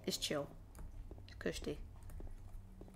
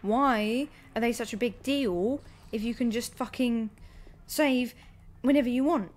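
loud, rising frustrated rant; exasperated rant; 3 semitones higher, 8 dB louder than usual. Real, not acted. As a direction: build it up to an outraged peak. Ranting about checkpoints.

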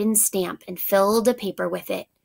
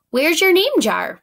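higher, louder urgent ask; urgent question; 7 semitones higher, 6 dB louder than usual. A narrator's reading. Ralph asks the class where the jar went, loud and urgent. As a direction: Ralph blurting out his worry. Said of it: "Ralph asked as soon as he saw it was gone".

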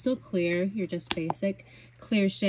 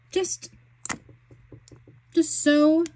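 high, louder squeal of delight; excited gush; 8 semitones higher, 5 dB louder, slower than usual. Real, not acted. Gushing over a swatch.

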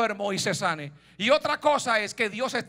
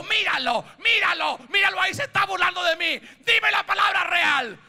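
high-pitched, building protest; indignant outburst; 8 semitones higher, 5 dB louder than usual. Acted. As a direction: complain hotly, then demand the truth. Rey indignado por la burla. (protesta indignada, en tono alto)

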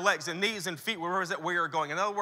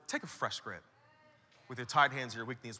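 low, hushed instruction; the Lord; 10 semitones lower, 10 dB quieter than usual. Acted. Answering the pastor who asked for rest, low and soft. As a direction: say it quietly and unmoved. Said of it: "the Lord was like".

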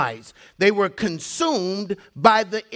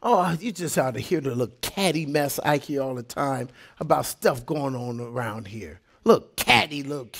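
usual speaking voice

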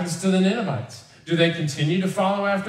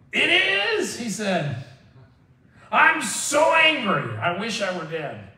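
a petulant Jonah, pitched way up, with a slow "I'm so angry"; mock angry Jonah; 6 semitones higher, slower than usual. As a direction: ham up a sulking prophet. Jonah answers God in anger.

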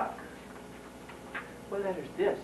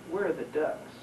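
usual speaking voice

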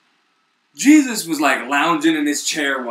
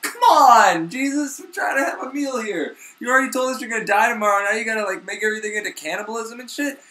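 higher, exasperated whine; whiny complaint; 7 semitones higher than usual. Retold or imitated. Disciples whining at Jesus over dinner, higher and exasperated. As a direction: complain like annoyed friends at dinner.

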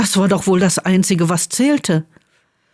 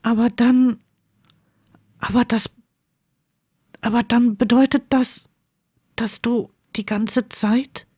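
stunned Draco; 3 semitones higher than usual. Acted. Draco stammering in realization.

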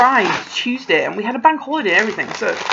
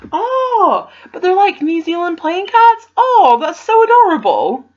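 high, loud, gushing; delighted squeal; 10 semitones higher, 7 dB louder than usual. Real, not acted. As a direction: coo over the cute cards. Unboxing a gift, delighted.

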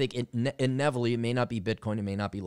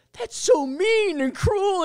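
very high, slow, loud mock girlfriend voice; shrill-free high mock scold; 6 dB louder, much slower than usual. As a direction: whine it like a scandalized girlfriend. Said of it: "my girlfriend's like".

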